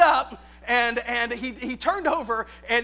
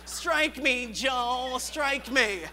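mock kung fu voice; much slower than usual. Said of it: "Just in that voice".

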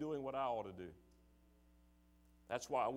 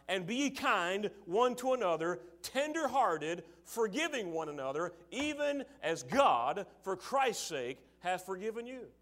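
higher, louder reading of scripture; 4 semitones higher, 4 dB louder than usual. Acted. Preacher quoting scripture to congregation, proclaiming. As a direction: proclaim the verse slowly.

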